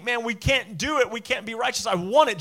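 high, loud cry of wanting; desperate plea. Retold or imitated. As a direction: plead like you can't reach it. Humble crowd voiced, desperate.

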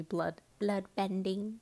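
slow, lightly voiced old lady; a hint of a creepy old lady, slowly. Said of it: "trying to be like the old lady".

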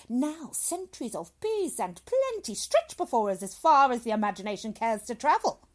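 loud, rising grand speech; orating doctor. Acted. Doctor hails centuries of peace, grandly orating. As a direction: swell into a visionary proclamation.

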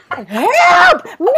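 high, loud whiny cry; imitating a wailing toddler; mock kid wail. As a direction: beg like a little boy. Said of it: "kid's reply imitated".

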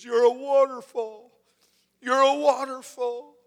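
high, loud, drawn-out imitation of her; crying woman's exclamation, retold. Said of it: "she says".

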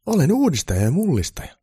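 high, loud enthusiastic declaration; excited. A narrator's reading. Elf declaring himself a reformer to Santa, excited. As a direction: proclaim it with eager excitement. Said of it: "haastattelija sanoi innoissaan".

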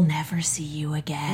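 hushed mock threat, whispered. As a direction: breathe it like a secret warning. Joking threat to a listener.

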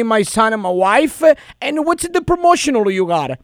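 prank caller in a thick put-on Italian accent. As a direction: play an Italian immigrant dad, hammed-up. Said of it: "but I got a lot of accent".

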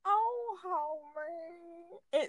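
quiet, very slow cartoon boy voice; lightly voiced cartoon boy, held back; Bart Simpson voice. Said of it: "Bart Simpson?".

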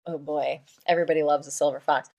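ordinary talk, nothing marked

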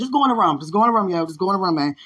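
loud, fed-up dismissal; fed-up mom. Retold or imitated. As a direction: send him off in exasperation. Mom sending son away.

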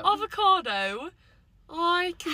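high, drawn-out British accent; mock British accent. Shown as a demonstration. Friend repeats her British demo word, mock British. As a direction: put on a slow, lilting British accent. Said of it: "I was using a British accent".

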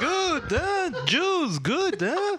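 high, swooping mock accent; Dracula-like accent. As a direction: ham up a silly Dracula accent. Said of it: "You guys sound like Dracula".

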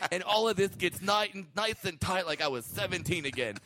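high, raspy Joan Rivers voice; Joan Rivers impression. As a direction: do Joan Rivers, brash and gossipy. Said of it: "Was that a good Joan Rivers?".

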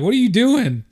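high-pitched mock exasperation; exasperated retold reply. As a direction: re-enact protesting at a clumsy teammate.

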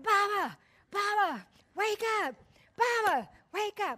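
high, loud urgent calls; calling to wake him. Retold or imitated. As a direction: shake him awake with your voice. Trying to wake unconscious pastor.

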